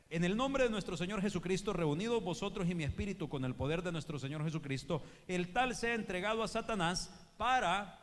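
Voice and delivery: loud, high scripture proclamation; building preacher's roar, slowing to the end; preaching at full force. Acted. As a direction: thunder the verse out to the church.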